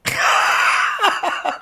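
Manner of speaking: sudden high burst of laughter; loud cackle. Real, not acted. Reader cracking up at her own narcissist remark, loud cackle.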